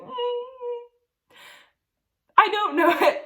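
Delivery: high, whimpering mock little voice; imitating her own embarrassed whimper to the teacher; whiny embarrassed reply. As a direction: squeak it out sheepishly.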